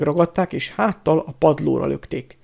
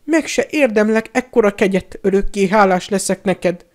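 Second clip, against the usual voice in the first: high, loud gushing thanks; abject, effusive cringing; grovelling gratitude; 6 semitones higher, 5 dB louder than usual. A narrator's reading. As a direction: fawn over your saviour, voice pitched up.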